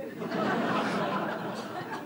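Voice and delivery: unvoiced chuckle; a soft airy laugh; breathy laugh. Real, not acted. Narrator laughs after aside.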